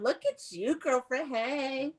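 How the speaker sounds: ordinary talk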